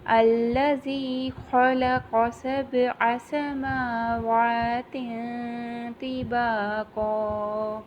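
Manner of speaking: slow, drawn-out tajweed chant; Quranic recitation. Shown as a demonstration. Teacher reciting for the Quran class, slow and drawn out. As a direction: recite it slowly, stretching each vowel.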